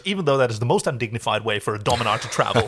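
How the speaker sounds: ordinary delivery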